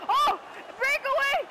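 high, drawn-out excited call; thrilled high exclamation; excited anticipation. Real, not acted. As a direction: call out the chance with rising hope. Spots a scoring chance.

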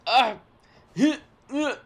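high strained grunting; straining grunts. Acted. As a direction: groan as if stuck. Soldier stuck, struggling.

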